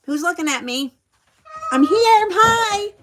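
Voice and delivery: high, loud mock entrance for all to notice; attention-seeking woman. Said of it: "she would".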